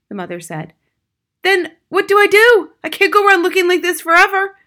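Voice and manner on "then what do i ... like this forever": high, loud desperate outburst; panicked father. A narrator's reading. Father despairs over his looks, panicked and loud. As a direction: demand help in a panic.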